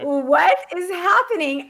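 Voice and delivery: very high, incredulous squeal; hammed-up shocked disbelief; stunned. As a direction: re-enact total bewilderment. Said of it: "'Cause I was, like, stunned".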